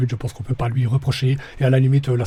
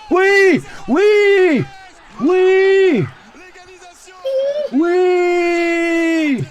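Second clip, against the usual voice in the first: ecstatic high shouts of joy; goal celebration; 4 dB louder, much slower than usual. Real, not acted. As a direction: scream with joy as your team scores. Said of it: "la voici d'ailleurs".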